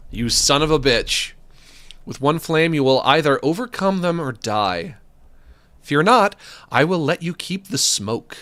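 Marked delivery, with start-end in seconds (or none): none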